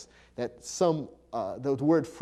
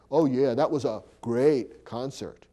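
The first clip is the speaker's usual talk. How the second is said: dry mock praise, a little louder; sarcastic; 5 dB louder than usual. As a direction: say it with an eye-roll, not meaning it. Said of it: "it's a way of being sarcastic".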